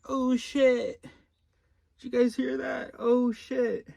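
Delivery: very high-pitched "uh-oh" voice; alarmed inner voice. Acted. Inner voice alerting the other voices, high and alarmed. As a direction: squeak it out like a startled voice in the head. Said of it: "and then a voice like".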